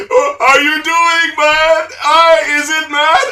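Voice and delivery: high, loud mock Jamaican accent. Mocking the sketch actor, put-on Jamaican accent. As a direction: imitate a random Jamaican accent. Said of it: "like, the Jamaican accent".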